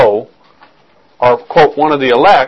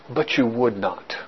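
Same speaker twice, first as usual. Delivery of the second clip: softer and slower, a grieving sigh of a line; sorrowful quiet lament; 5 semitones lower, 13 dB quieter, slower than usual. Retold or imitated. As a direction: let it sink, slow and soft with grief. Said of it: "He begins to cry".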